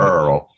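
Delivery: "Earl" low with a full R; practising "Earl"; repeating the word. Shown as a demonstration. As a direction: land the R in "Earl". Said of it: "the name Earl".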